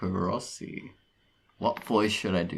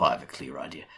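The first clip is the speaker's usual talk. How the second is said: put-on a lightly threatening Australian accent, a bit lower; 5 semitones lower, 4 dB quieter, much faster than usual. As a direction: read it as a menacing Australian. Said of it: "my more threatening Australian voice".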